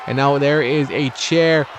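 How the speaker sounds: usual speaking voice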